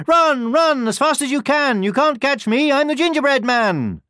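high, loud sing-out taunt; gleeful high-pitched storybook boast; taunting gingerbread man. A narrator's reading. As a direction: taunt pursuers in a high little voice. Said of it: "just laughed and said".

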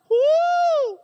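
high, loud exultant "woo"; joyful whoop. Real, not acted. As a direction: let out a celebrating shout. Exultant cry mid-sermon.

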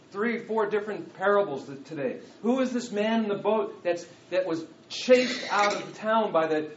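impassioned, insistent sermon delivery; forceful preaching. Acted. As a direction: press the rhetorical question on the congregation. Preaches a rhetorical question at force.

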